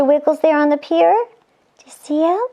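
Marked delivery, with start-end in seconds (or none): none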